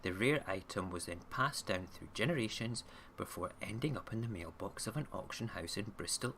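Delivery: usual speaking voice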